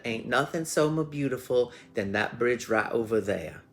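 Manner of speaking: hammed-up Southern storyteller; crazy Southern accent. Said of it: "speaking with this crazy little Southern accent".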